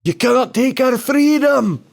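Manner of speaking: high mock Scottish battle cry; rallying cry in a hammed-up Scottish accent; Braveheart shout. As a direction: belt it like Mel Gibson in Braveheart. Quoting Braveheart, Mel Gibson.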